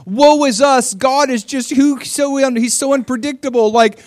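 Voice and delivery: high, loud mock whine; whining. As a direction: whine like a self-pitying victim. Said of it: "they're just, like, whining against God".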